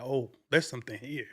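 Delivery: low, hushed thought to yourself; quiet realization. Retold or imitated. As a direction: murmur it as a dawning discovery. Retold private realization.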